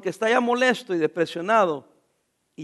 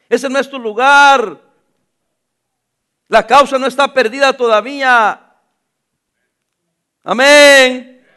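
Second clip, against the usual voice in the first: loud, slow, emphatic sermon lines; loud and drawn-out pulpit delivery; full-force preaching; 10 dB louder, slower than usual. Acted. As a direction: thunder God's call from the pulpit. Preaching God's words at full force.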